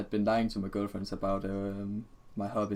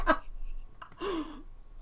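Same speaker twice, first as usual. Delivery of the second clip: very high breathless wheeze of a laugh; wheezing laugh; 5 dB quieter than usual. Real, not acted.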